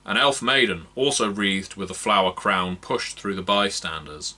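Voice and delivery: ordinary delivery, nothing marked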